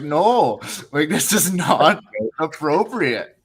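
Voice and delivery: firmly object, half laughing; mock-stern not appropriate; mock objection. Retold own objection.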